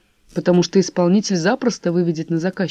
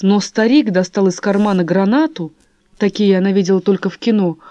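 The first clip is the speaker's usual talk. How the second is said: usual speaking voice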